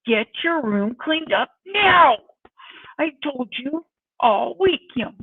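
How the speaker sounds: loud, exasperated order, stretched out; stern parent to teenager. Retold or imitated. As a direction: lay down the law to a teen. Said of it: "a totally different tone of voice".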